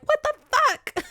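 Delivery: very high squeaked what the fuck; hammed-up shock at naked women; clan's shocked squeal at the naked wives; shocked mock cry. Clan sees naked wives.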